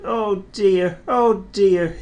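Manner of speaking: a touch of sleepy yawn in a high little engine voice; mild yawning. A narrator's reading. Thomas waking late, lightly yawning and sleepy. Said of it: "he yawned".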